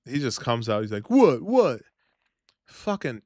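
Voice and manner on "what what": louder, higher retold challenge; cocky mock comeback. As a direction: imitate a brother spoiling for a fight. Imitates brother's challenge.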